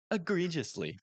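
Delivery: lightly pompous reading of egregiously. Retold or imitated. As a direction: say it like a snooty dictionary voice. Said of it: "in a pompous voice".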